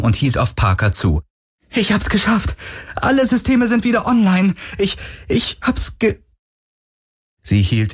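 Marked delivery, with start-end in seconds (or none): 1.7-6.2 hoch und atemlos stolz, am Ende abbrechend; kindlich aufgeregt. Acted. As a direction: sprudelt hoch vor Stolz, bricht dann ab. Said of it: "mit fast kindlicher Freude". (Kowalski meldet Parker stolz den Erfolg, hoch und aufgeregt)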